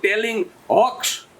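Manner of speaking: "hoax" said slowly for jokes, with h; very slow, "hoax" with h in place of j. Retold or imitated. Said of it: "જોક્સનું હોક્સ કરવું".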